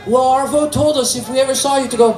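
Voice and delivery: put-on high child voice; innocent, high-pitched relaying; little boy. Grandson passing on grandpa's message, high child voice.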